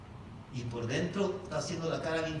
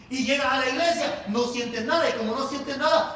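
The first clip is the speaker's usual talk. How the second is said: raised, pressing pulpit voice; insistent preaching; 7 semitones higher, 7 dB louder than usual. Real, not acted. Preacher describing a churchgoer who feels nothing, insistent preaching. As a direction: press the point on the congregation.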